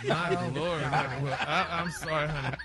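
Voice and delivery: laughing. Real, not acted. Host cracks up at gossip.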